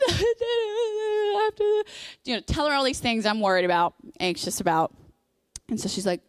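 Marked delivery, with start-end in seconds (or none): 0.1-1.8 high, drawn-out whiny babble; mock blubbering. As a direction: wail your worries in exaggerated blubber. Blubbers her worries to friend on phone.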